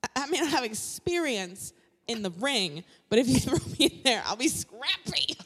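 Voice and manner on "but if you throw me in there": usual speaking voice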